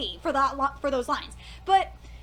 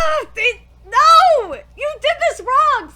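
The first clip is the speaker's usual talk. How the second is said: loud, higher yell; outraged shout; 6 semitones higher, 8 dB louder, slower than usual. Real, not acted. Fan yelling at the anime for a wrong scene, loud. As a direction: shout at the screen.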